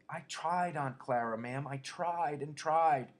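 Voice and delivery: high, miserable pleading, slowing at the end. A narrator's reading. Lenny pleads miserably to Aunt Clara. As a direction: plead miserably, drawing out the last tried. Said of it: "said Lenny miserably".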